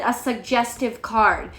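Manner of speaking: ordinary delivery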